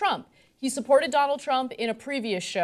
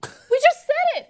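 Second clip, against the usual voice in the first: emphatic; 7 dB louder than usual. Real, not acted. Repeats for emphasis.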